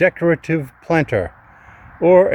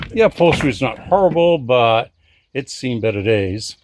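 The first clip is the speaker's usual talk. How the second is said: ordinary talk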